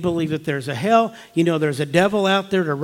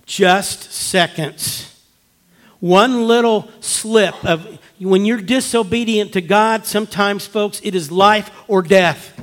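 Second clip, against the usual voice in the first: slow, louder, higher warning; preaching; 4 semitones higher, 5 dB louder, slower than usual. Acted. Pastor warning his flock, slow preaching. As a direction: drive home the stakes, drawn out.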